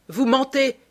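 high, loud accusation; shouting. A narrator's reading. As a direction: cry out in indignant disbelief. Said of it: "cria James Vane".

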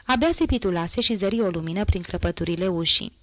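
plain reading voice

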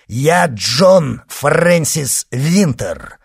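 loud, high, slow declaration; proclaiming. Acted. False monk reveals who he is, proclaiming. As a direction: proclaim the name word by word.

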